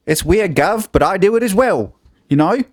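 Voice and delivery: high-pitched British accent, slipping towards Australian; wobbly British accent that lands near Australian; bad British accent. Shown as a demonstration. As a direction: put on a hammed-up British geezer voice, pitched high. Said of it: "that was borderline Australian".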